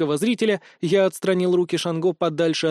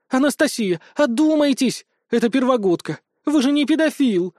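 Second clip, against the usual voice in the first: high-pitched scandalized protest; indignant; 7 semitones higher, 3 dB louder than usual. A narrator's reading. Priestess Ada rebukes Anastasia, indignant. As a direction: scold in a high, urgent voice. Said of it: "возмутилась жрица Ада".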